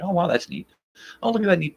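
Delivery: high, delighted mock gushing; gushing tourist. Guest admiring stuff in a house, in a high mock-delighted voice. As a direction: coo over the neat things like a wide-eyed visitor. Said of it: "You're like".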